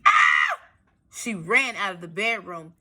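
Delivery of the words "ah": a sudden loud scream, much lower. Acted. A lightly voiced scream as the wolf leaps out. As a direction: shriek in fright.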